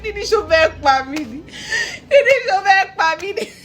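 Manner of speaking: high, loud imitated wail; mock crying. Mother carrying her found child, hammed-up crying. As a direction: act out tearful wailing, breaking into laughter. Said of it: "I was crying".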